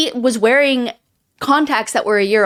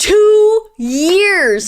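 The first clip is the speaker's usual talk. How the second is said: dramatic echo; 8 semitones higher, 5 dB louder than usual. Pretend.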